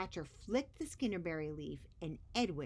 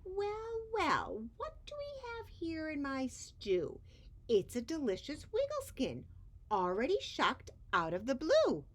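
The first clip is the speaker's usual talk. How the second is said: much higher, loud gloating creature; monster voice; 11 semitones higher, 3 dB louder than usual. Acted. Scratcher finds Edwin in his stew, high gloating. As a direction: crow over the catch like a ghoul.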